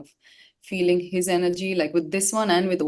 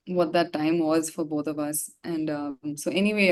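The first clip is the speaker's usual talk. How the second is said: normal talking voice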